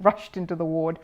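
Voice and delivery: usual speaking voice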